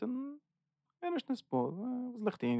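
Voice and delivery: a plaintive quoted "what should I do?"; voicing a jaded listener, high and soft; imitating a helpless person. Voicing a desensitized person's question.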